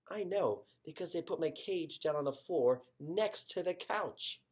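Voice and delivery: usual speaking voice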